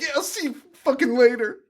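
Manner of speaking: high-pitched, half-hearted mock yell; toned-down mock scream. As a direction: imitate her yelling without full volume. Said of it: "She's screaming at the top".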